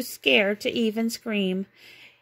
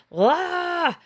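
sudden high yelp of fright; startled yelp; 9 semitones higher, 4 dB louder than usual. A narrator's reading. Mickey frightened by a figure in the attic, high yelp. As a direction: jump with a high scared cry.

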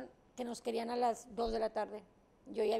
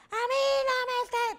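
mock sharp retort, raised; imitated sharp reply; 11 semitones higher, 7 dB louder than usual. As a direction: snap it back in imitation. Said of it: "así como te lo dije en bajito". (réplica imitada, alzando la voz)

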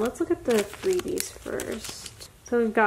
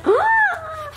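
a very high, loud gasp of delight; squealing gasp; 5 dB louder than usual. Real, not acted. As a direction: gasp like you can't believe it. Sees exciting card.

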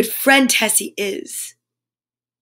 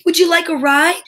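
higher-pitched called question; calling out; 6 semitones higher, 4 dB louder than usual. A narrator's reading. Noddy offering Mister Wobbly Man a ride, calling out. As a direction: offer it aloud to someone passing. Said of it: "called out".

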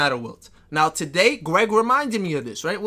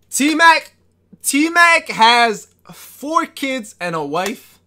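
loud, slow, higher call-out; hype announcement; 7 semitones higher, 8 dB louder, much slower than usual. Real, not acted. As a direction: announce it like a big reveal. Hyping a streamer shout-out.

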